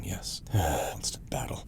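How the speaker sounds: sighed, whispered and slow. Real, not acted. Whispering to viewers as the battle starts. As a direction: hush it like a secret.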